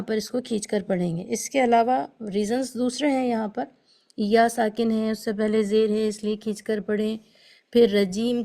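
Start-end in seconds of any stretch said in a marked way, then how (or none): none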